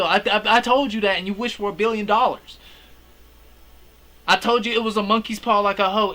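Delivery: loud, high-pitched mock outrage; hammed-up furious telling-off; angry scolding. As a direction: yell at him like an exasperated friend who warned him. Acts out Bear furious at Ian.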